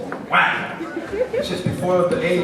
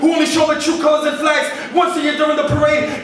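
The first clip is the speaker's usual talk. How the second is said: driving slam poem delivery, higher and louder than chat; spoken word poetry; 11 semitones higher, 4 dB louder than usual. Acted. Poet to the audience about the children of the wind, rhythmic slam delivery.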